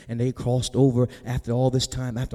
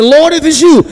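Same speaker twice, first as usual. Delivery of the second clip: loud, high shout to someone far off; Peter's urgent raised call; 14 semitones higher, 17 dB louder than usual. Acted. Peter calling to Jesus from the boat, calling out.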